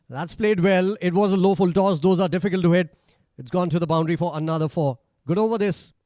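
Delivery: loud, high play-by-play call; live cricket commentary. Real, not acted.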